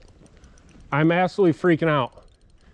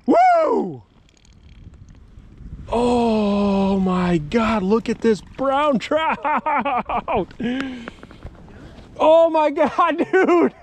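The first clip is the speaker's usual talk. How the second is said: shrieking, laughing joy much higher and louder; ecstatic whoop; 11 semitones higher, 3 dB louder, much slower than usual. Real, not acted. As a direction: scream with delight at the fish of a lifetime. Landed trophy trout, ecstatic.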